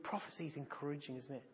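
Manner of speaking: usual speaking voice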